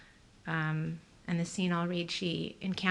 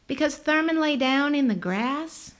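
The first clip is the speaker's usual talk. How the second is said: higher, lively woman's voice; 7 semitones higher, 6 dB louder than usual. A narrator's reading. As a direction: voice her high and bright.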